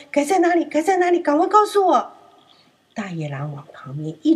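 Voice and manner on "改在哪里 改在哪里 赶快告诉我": high, louder urgent questions; frantic little lamb; anxious pleading. Acted. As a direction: lamb begging to know quickly. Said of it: "很着急地问：".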